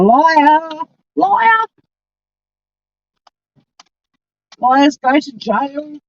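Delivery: high, slow teasing sing-song; childish mocking liar chant. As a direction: taunt it like a playground chant.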